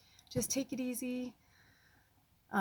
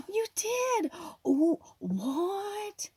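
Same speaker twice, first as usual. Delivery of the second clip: gasp, then high astonished exclaiming; delighted surprise; 12 semitones higher, much slower than usual. Real, not acted. Finding the crystal a friend sent, gasping surprise. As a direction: marvel at an unexpected present.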